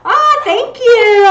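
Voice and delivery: high, slow gushing thanks; excited thanks. Real, not acted. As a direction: gush with delight.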